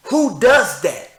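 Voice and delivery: high, loud, slow incredulous shout; outraged yell. Real, not acted. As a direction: blast the question at them. Same question, now shouted.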